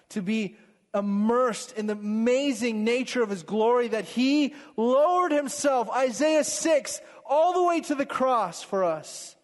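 swelling pulpit fervour; fervent preaching. Acted. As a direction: lift the congregation with rising passion. Builds to passionate preaching.

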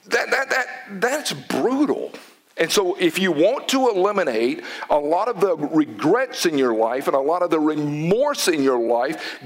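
usual speaking voice

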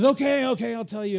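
loud, high mock exasperation; exasperated giving in. Samson caving to Delilah's wailing, loud exasperated surrender. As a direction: burst out as if worn down by nagging.

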